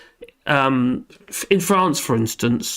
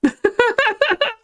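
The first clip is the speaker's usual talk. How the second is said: high giggle. Real, not acted. Laughing on stream.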